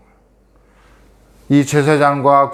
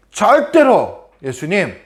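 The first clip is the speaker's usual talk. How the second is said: slow, higher insistence on 절대로; insistent vow; 6 semitones higher, slower than usual. Acted. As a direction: press hard on 절대로, slowly. Peter insists he won't deny. (절대로를 힘주어 천천히)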